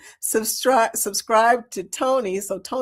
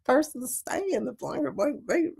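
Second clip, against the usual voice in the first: softer, higher imitated Kentucky twang; 4 semitones higher, 6 dB quieter than usual. Imitating the casino lady for the viewers, high Kentucky twang. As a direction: mimic her Southern Kentucky twang, pitched up. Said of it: "her Kentucky twang".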